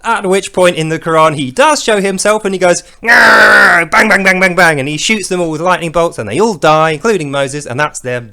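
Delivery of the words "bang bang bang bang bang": loud roar into rapid-fire bangs; explosive mock attack, starting high and falling; shouted bangs. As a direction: imitate lightning strikes at full volume. Imitates God firing lightning bolts.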